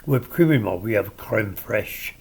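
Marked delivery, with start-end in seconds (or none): 1.2-2.1 creme fraiche said slowly, as if French; affected foreign word. Shown as a demonstration. Mocking Americans who use foreign words, affected creme fraiche. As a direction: savor creme fraiche with slow, pretentious care. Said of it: "likes to use a foreign word".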